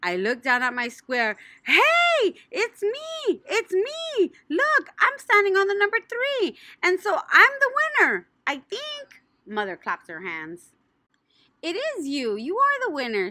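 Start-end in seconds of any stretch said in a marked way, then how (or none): 1.6-9.2 high, loud thrilled squeals; excited shout. Acted. Junie B shouting that she won. 11.6-13.3 yelled. Acted. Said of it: "she yelled".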